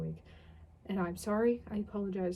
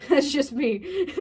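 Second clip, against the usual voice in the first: laughing; 5 semitones higher, 9 dB louder, much slower than usual. Real, not acted. Laughing at own rant.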